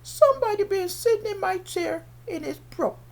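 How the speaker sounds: high, squeaky little bear voice; baby bear's high-pitched complaint. Acted. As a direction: complain like a tiny storybook bear. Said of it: "The baby bear".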